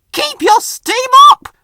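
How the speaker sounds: high, loud urgent call; worried shout. Acted. Rusty calls out to runaway Rheneas, loud and high. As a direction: urge him on at full voice. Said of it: "cried Rusty, looking worried".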